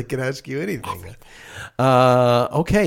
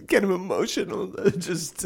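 choked up; 7 semitones higher, 3 dB quieter than usual. Acted. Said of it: "I'm getting a little choked up".

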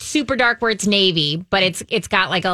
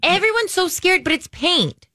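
excited; 10 semitones higher than usual. Real, not acted. Urging friend to paint door boldly.

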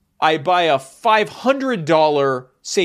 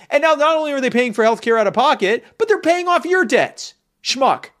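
heated rant; 9 semitones higher, 5 dB louder than usual. Real, not acted. Building rant ends in insult.

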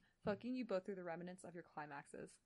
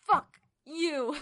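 mock snarl; 7 semitones higher, 14 dB louder than usual. Acting Kylo's line.

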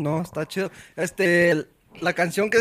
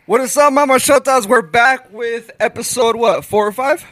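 upbeat, louder show opener; podcast intro; 5 semitones higher, 5 dB louder than usual. Acted. Host greeting listeners to open the episode, upbeat. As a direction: hype up the start of the episode.

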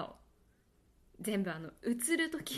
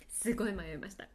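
ordinary delivery

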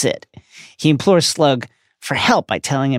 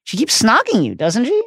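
high, baffled question; puzzled and disbelieving; bewildered; 9 semitones higher than usual. A narrator's reading. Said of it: "said Harry, bewildered".

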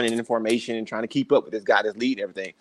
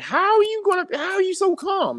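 much higher, far-off incredulous woman's voice; panicked passenger. Retold or imitated. Passenger asking the calm man how he stays calm, high incredulous voice. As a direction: squeak it out in disbelief. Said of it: "she's looking at me like".

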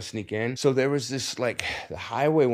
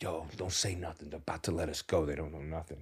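much softer, low conspiratorial murmur; hushed warning; 6 semitones lower, 12 dB quieter than usual. Retold or imitated. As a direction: keep it quiet so cops can't hear. Cuffed guy secretly hushes them.

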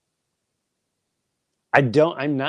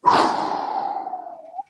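long breathy exhale; deep sigh. Real, not acted. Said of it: "I gotta take a deep breath".

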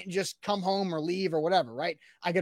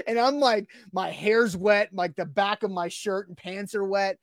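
ordinary delivery

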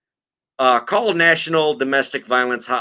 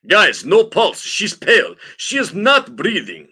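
put-on high, loud Russian accent; 6 semitones higher, 4 dB louder than usual. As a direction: announce it in a broad Russian accent. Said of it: "Russian accent actors in the house".

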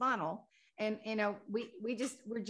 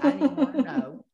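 laughing loudly; 7 semitones higher, 11 dB louder, faster than usual. Real, not acted. Laughing aside about relatives' names.

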